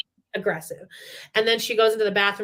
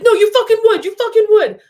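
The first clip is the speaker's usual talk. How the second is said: much higher and louder imitated shout; mock yell; 12 semitones higher, 10 dB louder than usual. Imitating the husband yelling at the producer, hammed-up shout. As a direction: bark it like the angry husband at the producer. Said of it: "And he's like".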